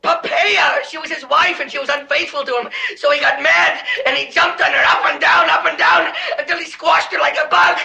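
shouting in growing terror; mounting panic. Acted. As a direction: build toward a pleading cry. Terrified he will be jumped on.

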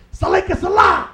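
faking the funk. Acted. Comic mocks brothers faking the funk, hammed-up shout. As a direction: bellow it like someone faking the funk. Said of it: "ones who are faking the funk".

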